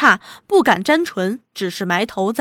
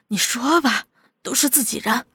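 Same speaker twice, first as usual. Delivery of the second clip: softer, anxious nudge; nervous urging; 6 dB quieter than usual. A narrator's reading. Nervous henchmen urging their chief to explain, softly. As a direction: urge him under your voice. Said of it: "赶紧怂恿他说明".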